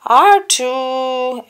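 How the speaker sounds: high loud call to the droid; Anakin calling. A narrator's reading. Anakin calls to his droid, loud high cry. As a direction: shout for the droid. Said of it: "Anakin cried".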